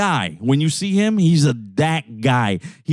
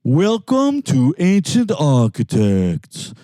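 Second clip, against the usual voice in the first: singsong YouTube lecturer; 4 semitones higher than usual. Retold or imitated. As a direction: stretch each word in a put-on presenter singsong. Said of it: "It goes like this. He'll be like".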